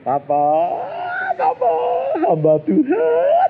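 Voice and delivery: high, slow, tearful wail; crying out. Retold or imitated. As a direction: wail it like a sobbing child. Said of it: "Saya yang ngomong gini". (meratap sambil menangis)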